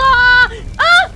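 high, loud short scream; shocked shriek. Real, not acted. Creature breaks out.

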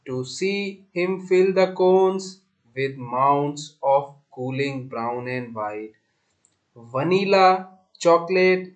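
very slow, measured poem recitation; poem reading. Shown as a demonstration. Teacher reading a poem to pupils before explaining it, slow recitation. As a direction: read it out phrase by phrase, drawn out and deliberate. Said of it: "एक बार इसकी reading करूंगा".

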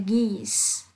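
"geese" said slowly with a hard g; slow hard g demo. Shown as a demonstration. Teacher showing students the hard g in "geese". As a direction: draw out "geese", hitting the hard g at the start. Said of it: "ngoại lệ cần phải lưu ý".

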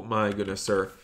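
ordinary talk, nothing marked

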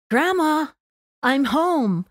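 bright, high call into the house; calling out to grandma. Retold or imitated. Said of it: "and announced".

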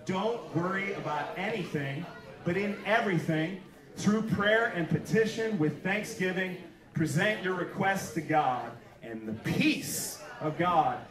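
slow, measured reading of the verses; slow Bible reading from the pulpit; scripture reading. Acted. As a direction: read the passage aloud, unhurried and solemn. Pastor reads Philippians aloud.

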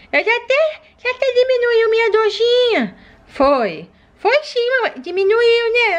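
put-on high little toddler voice; a small child talking to her mom; baby doll voice. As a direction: play the sick baby begging to play.

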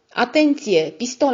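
loud, high alarmed warning; warning shout. Acted. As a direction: yell the warning to stop the shot. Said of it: "am strigat".

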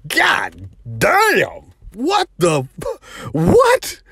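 a stunned cry after a sudden blow; shocked outburst. Retold or imitated. After the slap to his head, a stunned shocked cry. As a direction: pitch leaps up on the last what, stunned.

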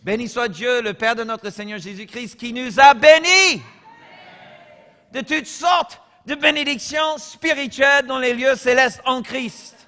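solemn fervent proclamation of the verse; true reading. Real, not acted. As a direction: proclaim the real verse with fervour. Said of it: "là je lis de, de vrai, ok?".

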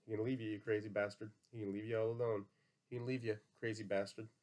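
plain reading voice

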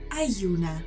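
ordinary delivery

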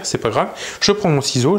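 ordinary delivery

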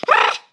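very high, loud startled shriek; shrill scream. Real, not acted. Player shrieking when a big cell appears. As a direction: shriek in sudden panic. Sudden danger while naming cell.

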